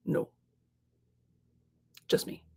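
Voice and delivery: near whisper, small voice; quiet admission. Retold or imitated. Author telling her sister it's only her, hushed. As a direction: confess it quietly. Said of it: "And I said".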